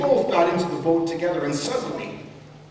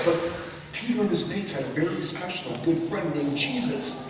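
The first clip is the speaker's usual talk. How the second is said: ordinary delivery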